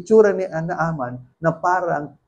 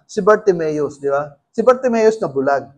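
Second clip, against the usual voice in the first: ordinary talk, nothing marked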